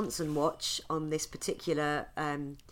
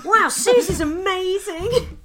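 excited shout; 14 semitones higher, 7 dB louder, slower than usual. Real, not acted. High far shout.

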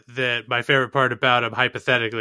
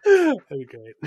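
laughing; 3 semitones higher, slower than usual. Real, not acted. Laughing while speaking.

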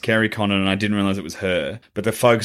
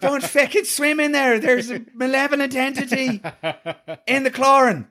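camp Irish doom; Irish accent; 13 semitones higher than usual. Retold or imitated. Irish wife warning of chlorine spirit. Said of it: "an Irish accent".

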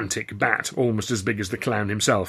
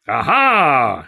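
loud, higher, drawn-out exclamation; triumphant cry; 4 semitones higher, 9 dB louder than usual. A narrator's reading. Old clown spots his rescuer, crying out. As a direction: greet him with a theatrical cry. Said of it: "he cried".

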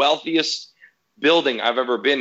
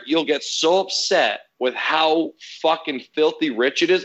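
ordinary delivery

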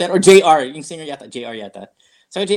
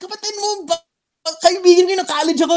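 much higher, eager insistence; excited retort. Retold or imitated. Telling his angry friend they are old friends, high and eager. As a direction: pitch it up high and pleading. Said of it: "and I said".

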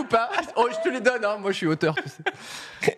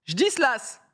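mis en scène; 8 semitones higher, slower than usual. Acted. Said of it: "tu mets le ton quand même".

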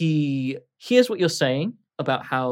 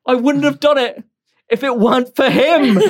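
put-on drunken wail; 11 semitones higher, 10 dB louder than usual. Drunk Grode blames Tony.

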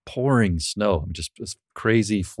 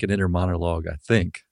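ordinary delivery

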